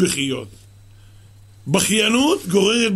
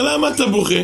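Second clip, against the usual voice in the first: louder driver asking; 7 semitones higher, 5 dB louder than usual. Retold or imitated. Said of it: "הוא אומר".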